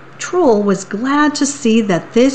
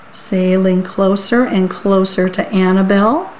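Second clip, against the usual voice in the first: ordinary delivery, nothing marked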